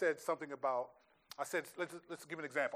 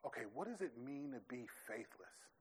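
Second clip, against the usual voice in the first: hushed, softer musing over the verse; meditative musing; 11 dB quieter than usual. Retold or imitated. Pastor showing how he meditates on a verse, hushed and musing. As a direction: read it quietly to yourself and wonder. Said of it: "I'll think, like".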